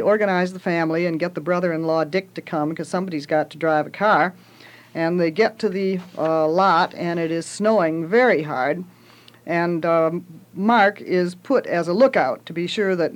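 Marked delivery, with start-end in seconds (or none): none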